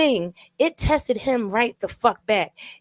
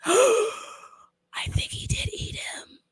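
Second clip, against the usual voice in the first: slow horrified whisper after a gasp; gasp, then whisper it in dread; real shocked whisper; 13 semitones higher, 4 dB quieter, much slower than usual. Real, not acted. Horrified realisation.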